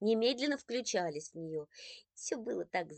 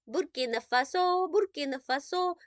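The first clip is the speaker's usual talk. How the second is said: little brother chanting the word up high and loud; gleeful yell; 8 semitones higher, 5 dB louder than usual. Acted. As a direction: holler it like a kid teasing. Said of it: "немедленно завопил Андрейка".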